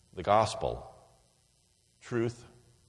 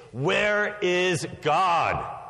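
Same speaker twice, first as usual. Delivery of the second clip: slow, higher and louder booming demand; booming pastor; 6 semitones higher, 3 dB louder, much slower than usual. A narrator's reading. Pastor shakes his finger at the boy, booming. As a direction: raise the voice and boom it at the boy. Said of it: "raised his voice even more".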